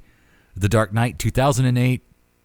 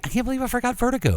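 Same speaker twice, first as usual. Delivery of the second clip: surprised; 4 dB quieter, faster than usual. Real, not acted. Realizes forgotten favorite film.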